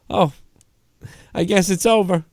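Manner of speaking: lightly voiced resigned streamer; impression of Cronin. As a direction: sigh it like a has-been giving up. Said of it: "That's a fairly good impression".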